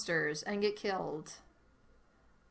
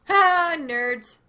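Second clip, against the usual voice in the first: loud gleeful taunt; triumphant; 6 semitones higher, 11 dB louder than usual. Real, not acted. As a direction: crow with victory. Triumph escaping.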